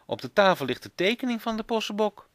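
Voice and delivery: put-on high, eager child's insistence; excited protest. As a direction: call it out, high and keen. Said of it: "roept Bas".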